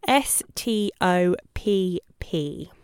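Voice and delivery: slow, letter by letter; spelled out slowly. Shown as a demonstration. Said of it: "remember the word stop".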